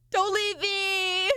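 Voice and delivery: high, slow fake sob; mock crying. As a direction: wail it with fake tears.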